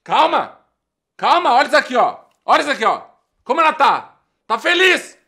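loud, high, outraged yelling; furious shouting. Acted. Dumped guy to his friend, furious loud shouting. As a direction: rage at the happy photo of her.

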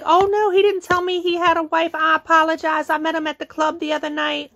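high, level retold excuse; mock apologetic other woman. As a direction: raise the pitch, running through her excuse.

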